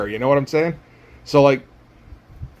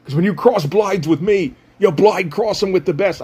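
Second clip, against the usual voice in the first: put-on horrible Cockney accent; 3 semitones higher than usual. Villain Knife taunting Hawkstab before a duel, in a hammed-up Cockney accent. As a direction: sneer it in a bad Cockney accent, like Kano. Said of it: "reworked all of his dialogue to do a horrible Cockney accent".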